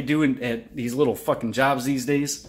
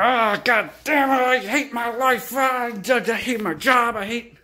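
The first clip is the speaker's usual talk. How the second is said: hammed-up misery; 7 semitones higher than usual. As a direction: drag it out like a sulking victim.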